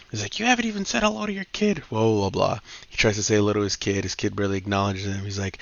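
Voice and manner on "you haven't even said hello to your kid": high, fast scolding wife voice; nagging wife. Retold or imitated. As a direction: snap at him like an annoyed wife. Wife scolds trucker husband.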